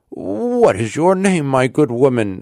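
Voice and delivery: put-on anxious old Rip. A narrator's reading. Rip questions his unknown daughter.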